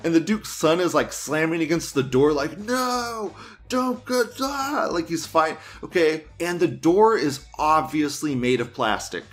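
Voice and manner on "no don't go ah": put-on high, panicked plea of a boy left behind; desperate cry. Son at the locked door begging Aquaman not to go, high panicked cry. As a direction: shriek it up high, begging him not to leave.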